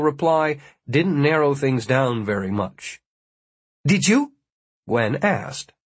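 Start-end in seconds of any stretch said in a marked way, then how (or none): 3.9-4.3 pressing question. Acted. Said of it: "pressing the issue".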